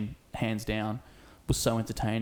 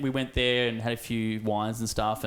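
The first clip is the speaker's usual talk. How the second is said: ordinary delivery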